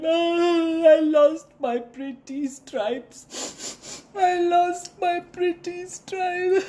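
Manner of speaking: high, slow crying lament; wailing. Acted. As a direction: cry it out, heartbroken. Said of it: "a wailing zebra".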